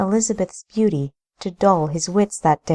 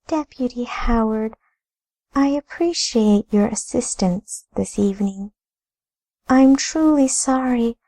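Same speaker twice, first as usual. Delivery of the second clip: higher, courteous lady; polite lady voice; 4 semitones higher than usual. Acted. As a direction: play a gracious lady dismissing a guest. Narrator voices Amelia.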